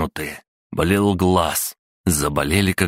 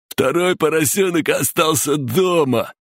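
very high sing-song taunt; childish high-pitched torture rhyme; taunting nursery rhyme; 12 semitones higher than usual. A narrator's reading. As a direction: chant it like a gleeful tormentor.

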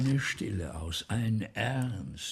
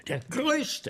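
much higher, exultant; rapturous cry; 13 semitones higher than usual. Acted. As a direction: burst out in admiration. Praising the great actor.

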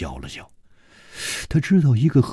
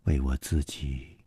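low, soft, slow confession; 6 semitones lower, 10 dB quieter than usual. A narrator's reading. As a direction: let it out slowly and quietly. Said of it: "缓缓地说". (缓缓地低声说)

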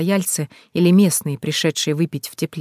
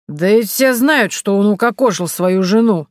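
put-on loud, higher drunken accusation; stubborn drunk jeering; drunk old man insisting; 4 semitones higher, 4 dB louder than usual. A narrator's reading. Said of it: "не унимался Бернард". (пьяно, настойчиво)

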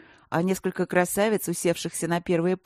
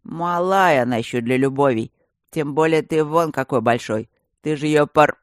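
put-on louder creaky old geezer; 2 semitones lower, 7 dB louder than usual. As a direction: play a nosy old geezer. Old stone face Ipolit rambles.

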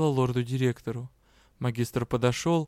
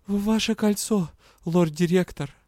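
higher, strained demand; forced out; 5 semitones higher, 3 dB louder than usual. A narrator's reading. Heroine demands the ring with difficulty. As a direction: force the words out. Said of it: "с трудом выговорила".

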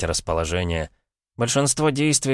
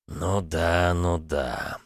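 скептическое хмыканье; much slower than usual. A narrator's reading. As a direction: agree with a light scoff. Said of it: "хмыкнул Камара". (негромко хмыкая)

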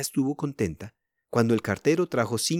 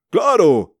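hearty agreement; 7 semitones higher, 10 dB louder than usual. A narrator's reading. Father joyfully agrees to request.

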